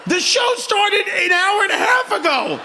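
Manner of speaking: high-pitched, hammed-up exasperation; mock scolding. Comedian scolds late front-row arrivals, high mock outrage. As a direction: berate them in playful disbelief, pitch way up.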